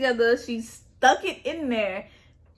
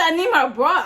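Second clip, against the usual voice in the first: pleading; 5 semitones higher, 6 dB louder than usual. Retold or imitated. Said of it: "I said".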